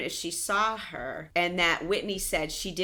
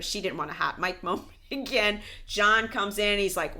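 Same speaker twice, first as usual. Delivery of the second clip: normal talking voice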